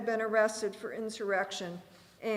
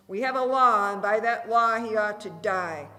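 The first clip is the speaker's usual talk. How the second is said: shouting at the top of his lungs; 10 dB louder than usual. A narrator's reading. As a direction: bellow the law at the crowd. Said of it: "shouted at the top of his lungs".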